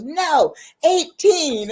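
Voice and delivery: loud, high-pitched mock outrage; retold emphatic correction. Professor correcting the student's guess, loud mock indignation. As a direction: land the punchline high and hard.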